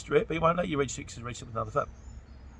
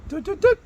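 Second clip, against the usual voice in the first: high sung "Doo, doo, doo" rising at the end; rising electronic beep tune; dishwasher startup beep; 7 dB louder than usual. Retold or imitated. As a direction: chirp it like a machine switching on. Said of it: "When you turn it on, it goes".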